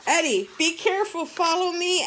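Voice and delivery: fast, high, loud squawk; urgent squawk. Acted. Al guiding Eddie in flight, squawking fast and high. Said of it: "Al squawked".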